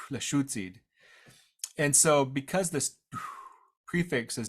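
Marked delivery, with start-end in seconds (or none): 0.1-0.8 Northern dialect accent, long "oo" vowel; Northern Lushootseed accent. Shown as a demonstration. Teacher confirming the other dialect's name, Northern accent. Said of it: "gonna do a Northern accent right now".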